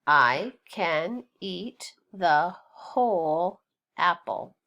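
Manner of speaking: slow, word-by-word reading; read-along. Shown as a demonstration. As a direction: read slowly so learners can join in, a gap after each word. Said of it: "Read it with me".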